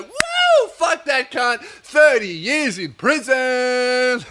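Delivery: high whoop, then stretched-out cheer; excited gloating. Acted. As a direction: whoop and crow over a win. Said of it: "that's how excited they are".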